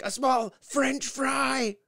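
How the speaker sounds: milk the disbelief; mock cashier. Cashier mocks the fry order, slow and sarcastic. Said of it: "And she's like".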